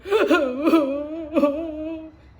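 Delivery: loud, high boo-hoo wail; loud mock sobbing. Lonely beaver crying by the pond, loud mock sobbing. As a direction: bawl like a lonely little beaver. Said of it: "Little Beaver was alone again".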